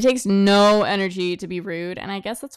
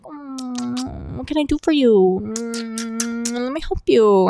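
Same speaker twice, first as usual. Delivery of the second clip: long drawn-out hums into eager offers; exaggerated cooing concern; mock sympathetic helper; 3 semitones higher, much slower than usual. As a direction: imitate someone fawning over a sad person. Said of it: "as soon as a sad person walks in, they're like".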